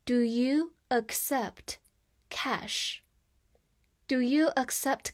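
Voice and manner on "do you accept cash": slow, with a clear break before the last word; slow read-along. Shown as a demonstration. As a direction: read it slowly in chunks for learners to repeat. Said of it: "再跟我慢慢读一遍".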